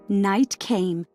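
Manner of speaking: plain reading voice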